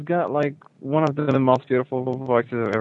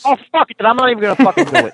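fast, much higher and louder yell; angry outburst; 10 semitones higher, 8 dB louder, much faster than usual. Real, not acted. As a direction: blow up in frustration. Frustrated caller snaps at challenge.

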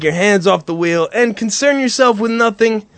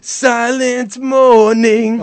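drawn-out mockery; much slower than usual. Shown as a demonstration. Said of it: "I just can't fucking listen to that shit".

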